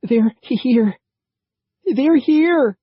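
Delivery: panicked, breathless high yell; terrified. A narrator's reading. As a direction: gasp it out in terror. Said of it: "gasping and looking anxiously back".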